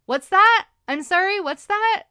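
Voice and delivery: loud, high pointed what's that; captain calling back. Retold or imitated. The captain turning to the heckler, loud and pointed. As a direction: call him out cheerfully. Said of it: "the captain just turned and was like".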